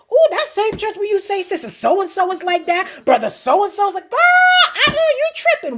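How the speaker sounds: high, loud, incredulous coworker; gossiping coworker. Acted. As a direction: put on a scandalized gossip's high voice, building to a squeal. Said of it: "and your co-workers like".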